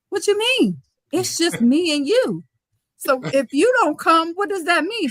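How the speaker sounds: put-on a young coach hearing she's alone, shocked and pleading; panicked disbelief. Shocked high-pitched disbelief.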